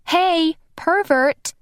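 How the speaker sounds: high, slow, scolding yell; drawn-out indignant shout; sharp call-out. Acted. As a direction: snap him out of it. Snapping him out of a trance.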